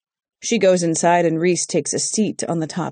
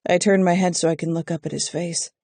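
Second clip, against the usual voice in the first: ordinary delivery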